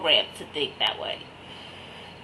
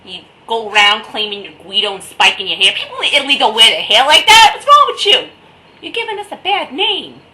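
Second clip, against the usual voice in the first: loud, higher ranting scold; hammed-up scolding of fake Italians; mock rant; 4 semitones higher, 11 dB louder than usual. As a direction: tell off the fake Guidos. Ranting at posers claiming Italian.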